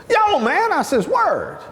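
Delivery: high-pitched, excitable cellmate; imitated insistence. Cellmate insists to the newcomer, high-pitched. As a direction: push it way up, insisting.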